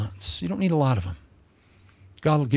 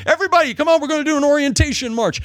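mock rally leader; 10 semitones higher, 5 dB louder, faster than usual. Mocking a preacher rallying people.

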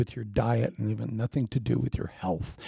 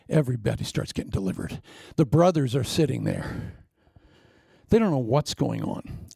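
ordinary delivery

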